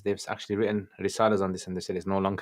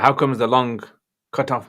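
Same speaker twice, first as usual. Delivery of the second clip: ordinary talk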